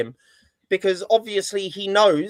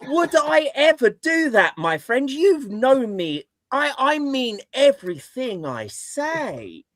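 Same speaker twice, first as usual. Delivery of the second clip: mock innocence; 6 semitones higher, 4 dB louder than usual. Feigning innocence after sarcasm remark.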